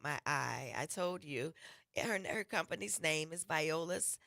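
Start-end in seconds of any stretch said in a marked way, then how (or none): none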